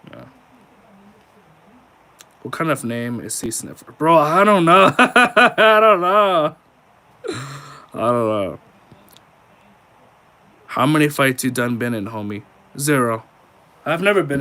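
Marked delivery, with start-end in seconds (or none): 3.9-8.6 laughing. Real, not acted. Laughing at odd name.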